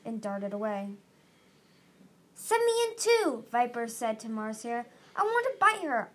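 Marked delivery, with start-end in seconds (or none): 2.3-3.4 high, loud, eager plea; clamor to be let in; eager demand. A narrator's reading. Eager fighter begs to join. 5.1-6.1 high, loud and raring to attack; eager demand. A narrator's reading. Eager fighter begs to attack.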